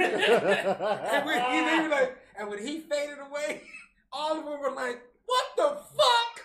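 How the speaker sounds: high, loud retelling through laughter; laughing retelling. Retold or imitated. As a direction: crack up while you imitate the stunned crowd. Theater crowd stunned, told laughing.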